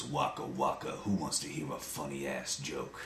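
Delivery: slow, low Fozzie in a deep voice. Retold or imitated. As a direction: Fozzie dubbed with a deep, dark voice.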